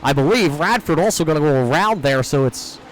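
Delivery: normal talking voice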